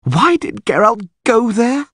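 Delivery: slow, high-pitched anguished groan; groaning dismay. A narrator's reading. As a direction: wail the question in despair. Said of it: "groaned Dandelion".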